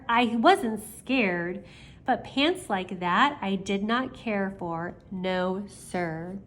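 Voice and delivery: ordinary delivery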